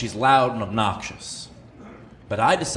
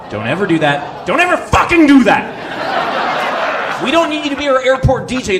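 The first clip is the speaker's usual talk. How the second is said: furious rant; 10 semitones higher, 9 dB louder than usual. Real, not acted. Comic scolding the loud woman at the gate, furious yelling. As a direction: rage at the inconsiderate music player. Ranting at loud music player.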